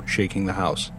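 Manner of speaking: usual speaking voice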